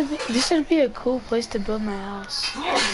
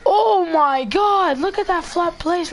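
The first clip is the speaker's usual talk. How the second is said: amazed; 6 semitones higher, 5 dB louder, slower than usual. Real, not acted. Amazed at the view.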